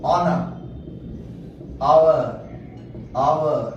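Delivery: teacher punches आवर as "our" for the class; vowel sound demo. Shown as a demonstration. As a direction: call out आवर, no h. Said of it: "ध्वनि जहाँ पे स्वर की".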